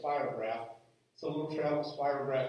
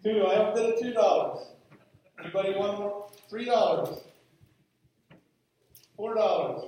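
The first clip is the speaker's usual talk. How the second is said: auction calling; 6 semitones higher, 8 dB louder than usual. Acted.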